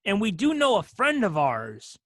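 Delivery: ordinary talk, nothing marked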